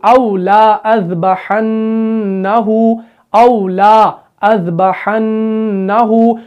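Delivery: औ ला अज़बहन्नहु drawn out slowly, recited with the extra alif silent; slow careful recitation of औ ला अज़बहन्नहु; Quranic recitation. Shown as a demonstration. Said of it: "अब तीसरा जो है वो है".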